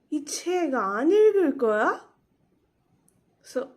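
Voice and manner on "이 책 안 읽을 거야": 이 책 안 읽을 거야 louder, climbing high at the end; question intonation. Shown as a demonstration. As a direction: ask 이 책 안 읽을 거야 with a rising finish. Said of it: "read as questions".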